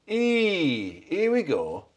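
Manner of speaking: slow, broad Yorkshire "'E, here we go"; Yorkshire accent. Retold or imitated. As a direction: draw it out like a Yorkshireman. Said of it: "if it were Yorkshire version, it would be".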